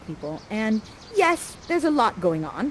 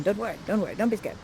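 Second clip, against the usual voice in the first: ordinary talk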